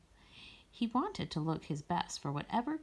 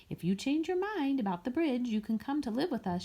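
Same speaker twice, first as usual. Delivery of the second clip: higher, friendly inviting call; a warm offer to an old friend; 6 semitones higher than usual. A narrator's reading. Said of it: "she invited".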